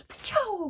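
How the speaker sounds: a high, sudden magical pshoo; a sparkly whoosh sound effect; transformation whoosh. Retold or imitated. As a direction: make the whoosh of a dress changing. Dress transforms in story.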